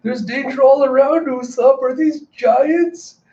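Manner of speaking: high-pitched mock panic; retold terror, stretched out at the end; tearful panic. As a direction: wail it as a frightened little character. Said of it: "tears streaming down his face".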